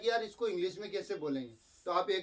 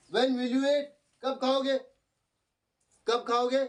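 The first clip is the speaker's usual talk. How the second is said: higher and louder; calling out question; 5 semitones higher, 5 dB louder, slower than usual. Retold or imitated. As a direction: call the question across the room. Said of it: "क्या बोला फिर हमने".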